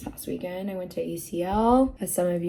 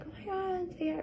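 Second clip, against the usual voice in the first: mock fan squeal; 12 semitones higher, 8 dB quieter than usual. Mimicking people who say hi.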